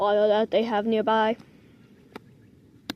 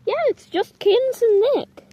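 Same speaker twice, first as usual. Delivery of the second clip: high, louder cooing pet voice; 10 semitones higher, 4 dB louder than usual. Real, not acted. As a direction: coo it up high to the kittens. Talking to the kittens.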